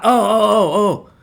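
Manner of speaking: high, loud flustered "oh, oh, oh"; mock flustered oh. Caught cheater blurting out in mock panic.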